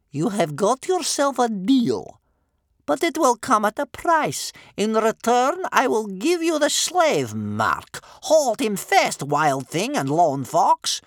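high, loud, slow pronouncement; commanding old grandmother. Acted. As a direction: lay down terms in grandmother's voice.

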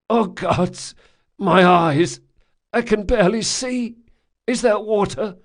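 high, loud pained panic; panicked distress. Acted. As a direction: cry out, blinded and scared. Locke half-blind, just revived.

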